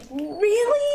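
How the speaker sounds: high-pitched mock disbelief; incredulous. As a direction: squeak it out as a disbelieving question. Incredulous reaction to hokey line.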